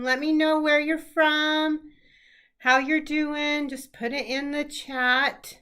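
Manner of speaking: ordinary delivery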